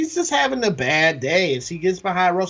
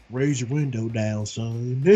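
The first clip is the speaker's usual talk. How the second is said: put-on Russell Crowe's Southern accent; 8 semitones lower, 4 dB quieter than usual. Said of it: "Russell Crowe has this southern accent".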